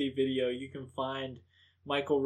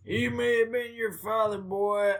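quoting Yondu; 8 semitones higher, 4 dB louder than usual. Retold or imitated. Quoting Yondu's movie line.

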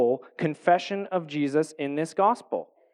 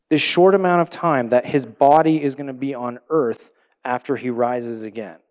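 normal talking voice

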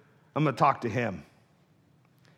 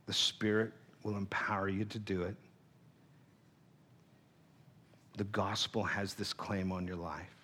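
inner voice; 8 semitones lower, 13 dB quieter than usual. Retold or imitated. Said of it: "And in my heart".

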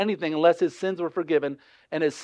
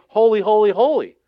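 "Holy" repeated loud and raised; emphatic, forceful triple "holy"; emphatic repetition; 4 semitones higher, 7 dB louder than usual. Shown as a demonstration. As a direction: punch each "holy" higher and louder. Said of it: "make something emphatic, they say it multiple times".

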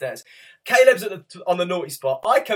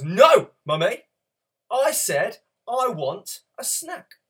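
put-on higher, louder, slow insistent toddler; mock toddler demand; 5 semitones higher, 5 dB louder, slower than usual. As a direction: stamp your foot like a demanding child. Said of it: "He gets a bit aggressive".

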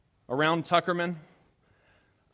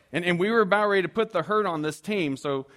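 ordinary delivery

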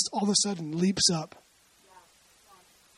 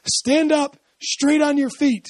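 high, slow, loud command to stand; 7 semitones higher, 4 dB louder, slower than usual. Retold or imitated. Paul commanding the cripple in a loud, slow voice. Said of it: "said with a loud voice".

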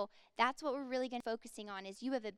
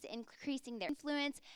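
ordinary talk

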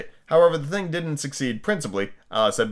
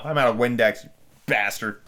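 ordinary delivery

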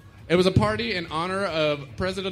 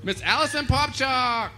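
announcer voice; 6 semitones higher than usual. Real, not acted. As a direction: present her like a game show host. Host announcing contestant.

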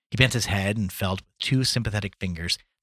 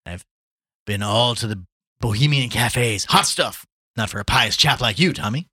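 lightly voiced bon vivant, louder; worldly Gallagher, enthusing; 3 semitones higher, 7 dB louder than usual. Gallagher tells Chandler of the life of Paris, enthusing. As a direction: sing the praises of a favourite city.

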